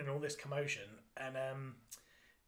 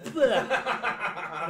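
laughing loud yuck sound; mock disgusted bleh; 5 semitones higher, 9 dB louder than usual. Kid tasting brie, a loud mock bleh.

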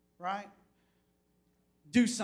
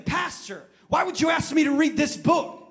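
loud, higher exasperated outburst; mock complaint; 8 semitones higher, 10 dB louder than usual. Congregation grumbling at their pastor, mock shouted complaint. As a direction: throw it out like a fed-up churchgoer. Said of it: "They're saying".

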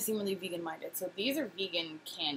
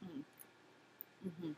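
chewing while speaking; talking around candy; mouth partly full; 2 semitones lower, 15 dB quieter than usual. Real, not acted. Seen 1: Bit ring at -4; chewing motions until ~1.1, holds ring throughout.

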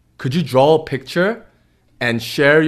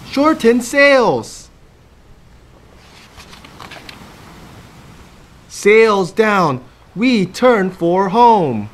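urgent storm; 6 semitones higher, much slower than usual. Acted.

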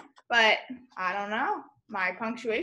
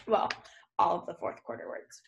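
whisper; 13 semitones lower, 6 dB quieter than usual. Real, not acted.

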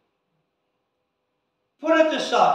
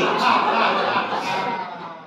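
hearty laugh at the joke; real laugh; 2 semitones lower than usual. Real, not acted. Preacher laughing at his own story, real laughter.

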